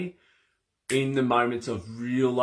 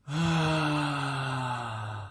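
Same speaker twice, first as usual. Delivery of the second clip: slow soft exhale, letting the tension go; long calming sigh; 3 semitones higher, 4 dB quieter than usual. Shown as a demonstration. Showing a listener a calming breath, long soft sigh.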